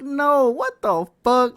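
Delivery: high, loud, drawn-out angry protest; mad as fuck. Retold or imitated. Player reliving the movie that kept going, mad outburst. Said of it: "I was mad as fuck".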